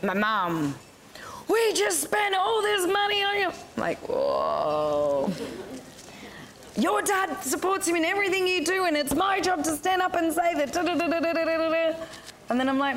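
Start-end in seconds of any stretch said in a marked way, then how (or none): 1.5-3.5 very high-pitched mock screech of a mum; outraged mother. Imitates outraged mother on phone. 4.1-5.3 low, drawn-out whoa; taken aback. Retold or imitated. Reaction to mother's outburst. 6.8-11.9 high-pitched nagging tirade, mock-outraged; scolding mother.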